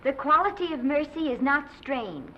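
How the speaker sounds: elocution recital. Acted. Drama pupil recites for her coach, formal and high. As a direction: recite it as a careful pupil would.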